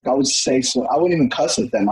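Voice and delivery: ordinary talk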